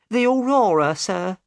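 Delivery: high, worried woman's voice; the boatman's anxious wife; high worried voice of Mrs Smith; upset wife. Acted.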